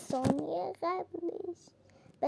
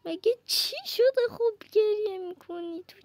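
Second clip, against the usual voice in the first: higher, mock-concerned tease; teasing; 5 semitones higher than usual. Real, not acted. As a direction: poke fun at the crybaby. Teasing crying character again.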